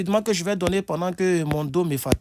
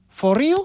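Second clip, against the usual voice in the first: for real with a high rising question tune, louder; doubting for real, sharp rise; 10 semitones higher, 6 dB louder than usual. Shown as a demonstration. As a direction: ask it, surprised: for real?. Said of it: "Mais si c'est sous forme de question".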